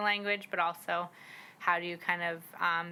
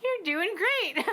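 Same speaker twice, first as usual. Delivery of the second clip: put-on much higher, slow, sweet little kid encouraging; child's cheer; 13 semitones higher, 5 dB louder, slower than usual. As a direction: cheer them on like a small child. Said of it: "kids are just like".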